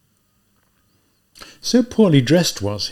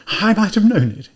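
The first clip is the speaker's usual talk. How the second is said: higher-pitched, louder flustered outburst; confused muttering; 9 semitones higher, 6 dB louder than usual. A narrator's reading. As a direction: voice a man caught out and confused. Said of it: "he muttered in confusion".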